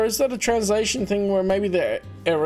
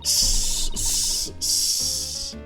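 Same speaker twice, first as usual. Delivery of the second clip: unvoiced snake hiss; much slower than usual. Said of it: "doing a snake voice".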